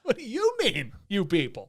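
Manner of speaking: YOU pitched high in "What do you mean"; indignant echo, leaning hard on "you"; stress on YOU. Acted. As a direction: hit the first "you" high, then drop back for "you people". Echoing the line with shifted stress.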